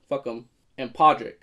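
usual speaking voice

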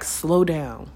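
said slowly and calmly; unhurried slow down, falling at the end. Shown as a demonstration. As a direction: ease off the pace on slow down. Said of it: "the message that I'm getting intuitively is".

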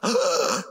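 imitated kids' gasp; shocked gasp. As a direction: gasp like startled children. Kids react to mask coming off.

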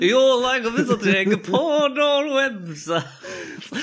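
high, slow, squealed mock voice. Friend parroting a scam email in a high mocking squeal. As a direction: squeal it back to tease a friend.

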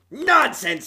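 high, loud dismissive ghoul; ghoul scoffing. Acted. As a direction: brush it off like a brutish ghoul. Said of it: "said the ghoul".

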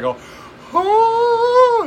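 high, loud drawn-out "oh"; mock wail. Retelling the Rob Schneider teasing to co-host, mock wail.

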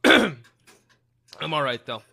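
hoarse after choking; throat clearing. Real, not acted. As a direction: clear the throat, then speak. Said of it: "a flake of almond in my throat".

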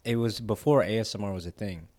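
ordinary delivery

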